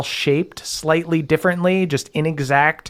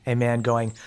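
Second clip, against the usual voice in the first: ordinary talk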